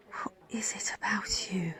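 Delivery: whispered to herself, soft and breathy-free; private whisper. Acted. Nurse wondering about her patient, whispered. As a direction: hush it like a secret thought.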